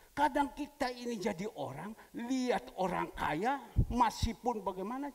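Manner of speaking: loud, high, impassioned sermon; full-force preaching; fervent preaching. Real, not acted. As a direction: drive the point home to the congregation.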